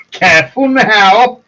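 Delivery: normal talking voice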